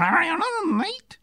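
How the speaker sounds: mangled high-pitched Australian accent, words slurred into sounds; attempted Australian accent. Shown as a demonstration. Guest proving he can do Australian, garbled Australian accent. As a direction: botch an Australian greeting, rising sharply at the end. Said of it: "I can do Australian".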